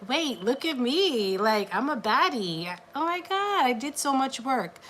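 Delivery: mock-proud squeal of self-praise; proud excitement. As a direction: gush about your own glow-up. Imagined proud client quote.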